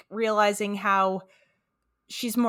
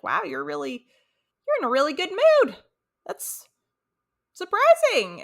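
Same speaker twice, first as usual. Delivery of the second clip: imitated surprise; 5 semitones higher, 3 dB louder than usual. Voicing Hank surprised by Peggy's mood.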